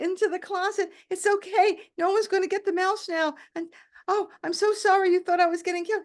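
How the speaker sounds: high, breathless soothing; calm him down while flustered yourself; frantic reassurance. Retold or imitated. Patient calming her panicked doctor, flustered.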